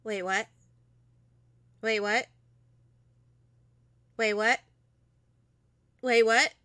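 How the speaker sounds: stunned disbelief. Real, not acted. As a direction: build from puzzled to loud disbelief. Repeats stunned reaction to game reveal.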